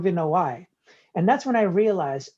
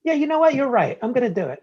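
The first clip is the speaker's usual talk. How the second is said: eager mock agreement; 4 semitones higher, 6 dB louder, faster than usual.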